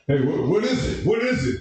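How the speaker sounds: pushy general, pitch climbing; demanding general. Retold or imitated. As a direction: bark the question up at the private. General questioning private, acted scene.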